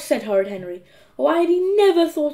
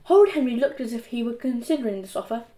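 ordinary delivery, nothing marked

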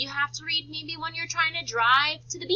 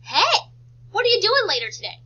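louder, higher cheery sister voice; chirpy sister; 7 semitones higher, 10 dB louder than usual. Retold or imitated. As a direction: barge in bright and nosy. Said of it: "She came in the room and said".

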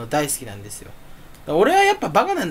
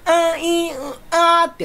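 stretched mouth vowels; 12 semitones higher, slower than usual. Shown as a demonstration. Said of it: "口を、えーってね".

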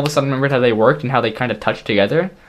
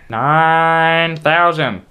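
drawn-out announcement; 4 semitones higher, 3 dB louder, much slower than usual. Acted. Milestone announcement.

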